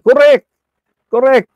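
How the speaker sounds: high, loud "Correct!" shouted twice; excited agreement. Retold or imitated. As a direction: cheer "Correct!" like a delighted fan. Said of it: "sabi ni kapatid na Trexie". (masiglang sigaw na "Correct!")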